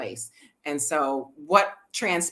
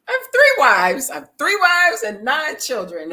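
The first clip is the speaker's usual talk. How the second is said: louder, higher proud boast of three wives; 7 semitones higher, 8 dB louder than usual. Retold or imitated. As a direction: announce it like a proud husband. Quoting Baba's proud answer.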